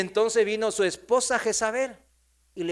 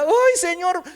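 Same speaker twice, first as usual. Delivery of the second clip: very high, loud acted wail; anguished cry out; 12 semitones higher, 7 dB louder, slower than usual. King Acab repents when Elías confronts him, loud mock wail. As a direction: cry up to God, hammed-up.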